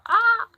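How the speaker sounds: sudden high yelp of frustration; a sharp loud "Ah!" as the twister fails; frustrated shriek. Real, not acted. A streamer failing a tongue twister, shrieks in frustration.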